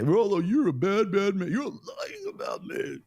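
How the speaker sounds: mocking an opponent who calls him a liar; mock accuser. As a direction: whine it like a hurt, accusing opponent. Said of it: "if you wanna say".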